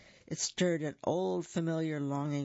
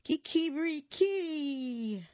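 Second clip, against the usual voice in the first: high slow crow falling at the end; drawn-out high rooster call; 12 semitones higher, much slower than usual. A narrator's reading. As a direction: crow it out defiantly. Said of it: "And he did".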